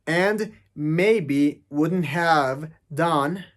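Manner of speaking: very slow, word by word; careful slow repeat of the quote; slowed-down demo. Shown as a demonstration. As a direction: pull the line apart slowly. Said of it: "lo pronuncia sin la V".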